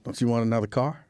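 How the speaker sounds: low, repeated coaxing plea; lightly acted pleading with your partner; placating partner. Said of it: "I'll be like".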